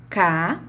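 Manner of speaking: "Cá" on its own, held out; isolated syllable. Shown as a demonstration. Teacher counting off the second syllable, deliberately isolated. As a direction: set "Cá" apart as a separate syllable. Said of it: "segunda sílaba".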